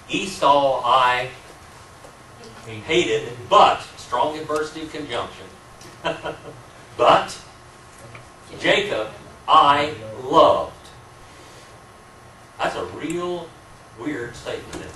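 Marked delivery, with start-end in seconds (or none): 0.1-10.7 slow, weighty reading, every word set apart; solemn scripture quote. Shown as a demonstration. Teacher quoting God's words to the class, slow and emphatic. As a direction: slowly lean on "Esau" and "Jacob". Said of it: "God says in several places in the Bible".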